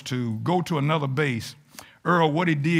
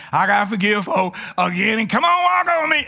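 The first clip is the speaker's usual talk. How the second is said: mock churchgoer shout. Mocking a churchgoer's resolve.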